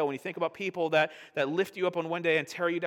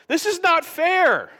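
much higher, louder and slowed, wailing each word; anguished protest; 14 semitones higher, 11 dB louder, much slower than usual. Retold or imitated. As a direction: cry out the injustice to God. Acted lament to God.

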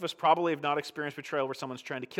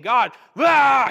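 a much higher, much louder wail of God, ah; anguished cry; 9 semitones higher, 14 dB louder than usual. Retold or imitated. Voicing David's anguished cry.

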